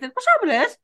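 a retold beautician in a thick Irish accent, pushing a little; pure Irish accent. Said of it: "you know, pure Irish, like".

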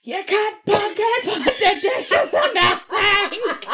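high, slow mock scream with laughter; retold scream. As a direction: act out screaming at him, half laughing. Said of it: "literally screaming".

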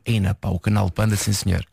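Porto accent. Shown as a demonstration. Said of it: "Imagina uma frase do género assim". (sotaque do Porto, voz mais grave, a brincar)

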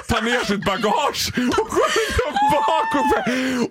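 high laughing cry, fast running together; laughing shout. Real, not acted. Laughing while telling friends about the shameful walk.

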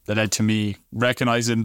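ordinary talk

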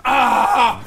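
short high mock rock yell; a shouted "Ah!" over the shoulder; retold stage yell. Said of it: "og så råber han".

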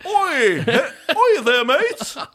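high British lout call, laughing; mock British accent. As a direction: tease with a hammed-up British yob voice. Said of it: "drar på sånn britisk aksent".

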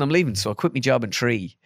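ordinary talk

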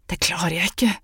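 unvoiced, pained whisper; hissed whisper. Woman in labour hissing back at the angry man. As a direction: hiss it out in labour. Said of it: "hveste hun".

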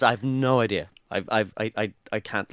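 low, halting, softening toward the end; baffled reply. Retold or imitated. Friend shown a baffling text, low and stumbling. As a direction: stumble over it, at a loss.

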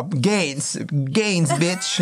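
high, punchy mock voice; imitated girlfriend's retort.

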